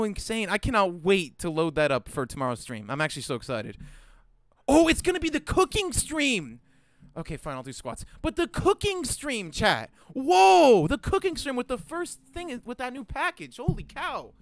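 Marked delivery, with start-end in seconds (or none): 4.7-6.6 excited. Real, not acted. Thrilled about tomorrow's cooking stream. 8.2-14.3 high-pitched gushing, stretching the words; excited. Real, not acted. Thrilled about new package and stream.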